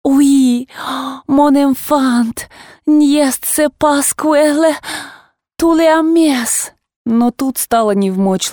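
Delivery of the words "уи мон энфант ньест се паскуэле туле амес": cooing mother. Acted. Mother coos to child in French.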